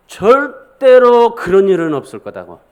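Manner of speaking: loud, slow, emphatic protest; forceful refusal. Acted. Peter refusing to accept Jesus' death, forceful. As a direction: hammer out 절대로, drawn out and loud. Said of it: "강한 어조로 주님 앞에 그렇게".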